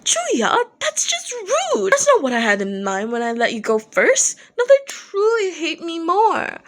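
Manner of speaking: high, indignant sputter; spluttering. Acted. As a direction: splutter it, caught off guard. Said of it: "Dazai spluttered next to him".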